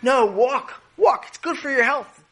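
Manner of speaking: high-pitched insistent wife voice; nagging farmer's wife. Acted. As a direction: order your husband to walk in a high voice. Wife orders farmer to walk.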